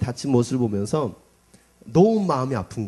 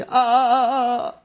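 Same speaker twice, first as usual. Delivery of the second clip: imitated drawn-out wail; 9 semitones higher, 6 dB louder, much slower than usual. Imitating daughter's whimper.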